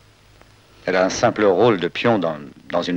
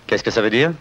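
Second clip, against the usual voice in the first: normal talking voice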